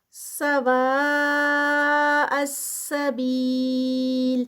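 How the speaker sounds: سواء السبیل chanted very slowly, madd held long. Shown as a demonstration. Teacher demonstrating connected madd in recitation. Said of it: "اس کا stretch دیں گے".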